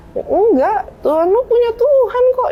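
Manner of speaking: high-pitched mock objection; argumentative retort. Opponent challenging him about his God, argumentative.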